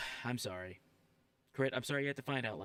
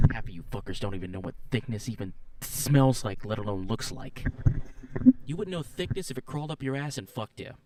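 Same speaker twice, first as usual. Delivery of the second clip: loud, furious chewing-out of the chat; angry rant; 2 semitones lower, 10 dB louder than usual. Real, not acted. Furry ranting at chat who don't know thickness, loud and angry. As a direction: yell it at them, fed up.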